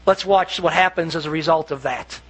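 ordinary delivery

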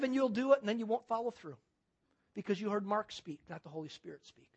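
usual speaking voice

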